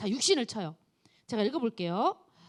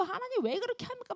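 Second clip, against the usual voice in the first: mock complaint; 10 semitones higher, 4 dB quieter than usual. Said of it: "비난하고 원망하고".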